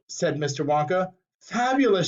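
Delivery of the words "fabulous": excited shout. A narrator's reading. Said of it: "shouted Violet".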